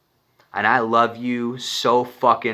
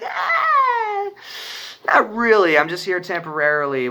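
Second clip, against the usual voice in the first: much higher laughing brush-off; laughing reply; 9 semitones higher than usual. Retold or imitated. Answering excited friends about being back, much higher laughing reply. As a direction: laugh it off lightly.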